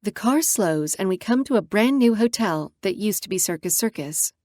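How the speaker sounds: ordinary delivery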